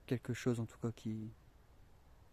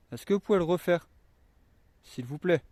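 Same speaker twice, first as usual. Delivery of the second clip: calling out loud; 5 semitones higher, 8 dB louder than usual. Real, not acted. Calling out to spirits.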